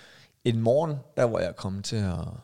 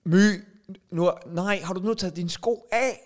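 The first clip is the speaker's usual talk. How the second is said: higher, louder, put-upon father; exasperated dad; 6 semitones higher, 3 dB louder than usual. Acted. Father scolding My about her shoes, higher and louder. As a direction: play the harried dad scolding.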